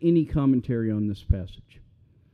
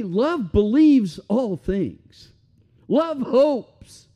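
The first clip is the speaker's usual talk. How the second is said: fervent; 8 semitones higher, 4 dB louder, much slower than usual. Acted. Preacher quoting scripture with fervour.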